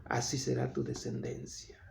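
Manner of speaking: hushed promise. Acted. Preacher voicing God's promise to Abraham, hushed. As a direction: drop to a soft, solemn hush as God speaking.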